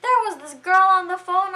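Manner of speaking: high, loud mock-woman voice; exaggerated girly retelling; imitated gushing woman. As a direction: pitch it way up, loud and dramatic. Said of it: "so she's like".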